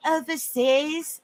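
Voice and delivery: imitated Australian accent, drawn out with a rising end. Quoting the lady at the service, imitated Australian accent. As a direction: say it slowly in a mock Australian accent. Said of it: "just in her accent".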